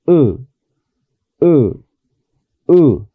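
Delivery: "U" with lips barely parted, short; short English U. Shown as a demonstration. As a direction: say "U" relaxed, lips apart. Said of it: "¿cómo debería de sonar?".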